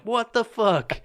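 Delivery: high shocked mock outburst; disbelief. Reacting to the drunk girl near the kids, high disbelief. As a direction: squeak it out in disbelief. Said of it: "I'm like".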